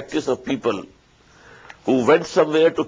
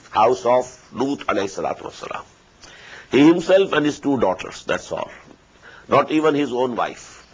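ordinary delivery

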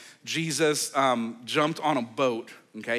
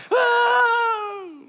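high, loud startled cry; shocked yell of the disciples; startled shout; 7 dB louder than usual. Retold or imitated. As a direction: jolt in shock. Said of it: "the disciples are like".

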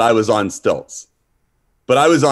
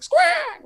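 very high screeching squawk; high-pitched prehistoric bird screech; pterodactyl squawk; 3 dB quieter than usual. Retold or imitated. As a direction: imitate a pterodactyl with one piercing squawk. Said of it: "it goes like".

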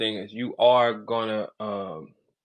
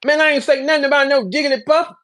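high, louder whiny denial; mocking denial; 12 semitones higher, 6 dB louder than usual. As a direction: mimic a rapper lying defensively. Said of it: "tell people".

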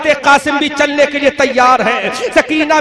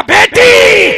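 anguished cry; 10 semitones higher, 9 dB louder, much slower than usual. Acted. Father answers the daughter.

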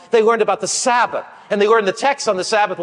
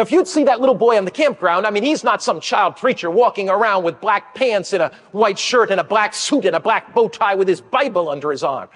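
usual speaking voice

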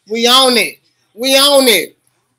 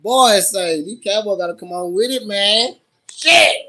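ordinary talk

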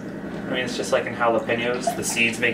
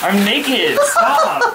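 high, loud laughing shriek; 7 dB louder than usual. Real, not acted. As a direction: shriek at the intruder. Walked in on shower.

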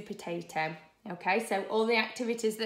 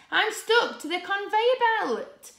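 high, loud storybook panic; crying out in distress; 12 semitones higher, 8 dB louder than usual. Acted. Carrot stuck on the conveyor belt, crying out in distress. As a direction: wail it as a trapped character. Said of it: "cried Carrot".

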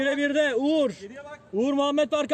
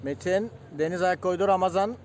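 ordinary delivery

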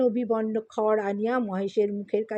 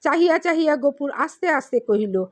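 ordinary delivery, nothing marked

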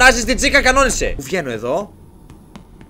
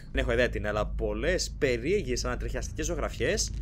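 normal talking voice

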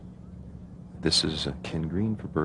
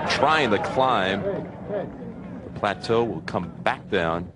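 golf commentator; 4 semitones higher, 3 dB louder, much slower than usual. Real, not acted. Live call of a golf shot.

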